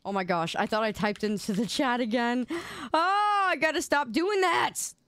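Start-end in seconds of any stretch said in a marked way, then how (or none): 2.9-4.9 alarmed. Real, not acted. Almost typed password again.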